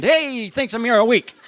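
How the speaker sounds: high, sing-out punchline tag; hammy comedian catchphrase. Retold or imitated. Comedian congratulating himself after cracking a joke, hammed-up high call. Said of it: "then I'll go".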